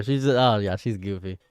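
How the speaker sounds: ordinary talk, nothing marked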